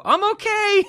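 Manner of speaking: put-on very high, loud child's call; mock kid voice shouting high; imitated kid. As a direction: pipe it up loudly like a little kid. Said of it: "hear the kid go".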